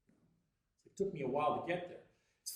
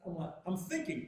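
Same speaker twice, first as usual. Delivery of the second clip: ordinary delivery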